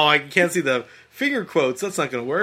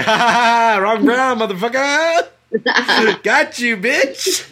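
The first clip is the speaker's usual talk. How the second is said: laughing, higher and triumphant; gloating laugh; 5 semitones higher, slower than usual. Retold or imitated. Mocking the scam caller who guessed wrong, gloating laughter. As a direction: crow at the scammer through laughter. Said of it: "And I was like".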